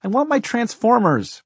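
put-on very high, loud child wail; piercing little-kid whine; whining little boy. A narrator's reading. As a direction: demand toys like a toddler. Little brother whines for toys.

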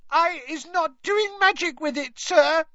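very high, squeaky elf voice; squealing Winky. A narrator's reading. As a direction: squeal a frightened denial up high. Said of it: "squealed Winky".

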